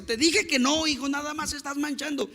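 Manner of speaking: high-pitched imitated parental telling-off; scolding father. Father scolds son a third time, mock exasperation. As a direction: play a dad who has had to repeat himself. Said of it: "el papá le dice".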